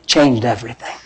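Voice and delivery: a near-whispered echo of the point; hushed repeat. Acted. Pastor to congregation, quietly letting the change land. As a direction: drop low and soft, letting it sink in.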